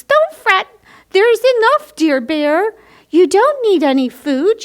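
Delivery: high, loud squeaky mouse voice; little mouse reassuring the bear in a high squeak. Acted. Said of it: "Mouse squeaks".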